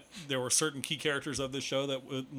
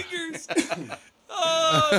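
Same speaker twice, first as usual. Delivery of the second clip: laughing; 7 dB louder, much slower than usual. Real, not acted. Group laughing fit.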